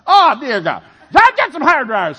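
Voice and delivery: high loud alarmed shout; panicked cry. Retold or imitated. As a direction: cry out in alarm for hair dryers. Panic at frozen heat pump.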